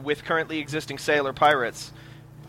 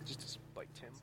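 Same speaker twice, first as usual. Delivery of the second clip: whispered aside; 26 dB quieter than usual. Real, not acted. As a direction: whisper it under your breath.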